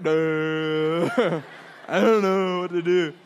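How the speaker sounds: imitating a dopey, dumbstruck kid; drawn-out mock helplessness; clueless middle schooler. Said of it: "I'm in middle school".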